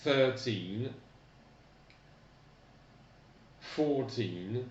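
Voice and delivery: put-on "Thirteen" with stress on the first syllable and a lengthened teen; stressed first syllable, long ee. Shown as a demonstration. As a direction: hit the first syllable, stretch the ee. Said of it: "Donc accent tonique, puis i allongé".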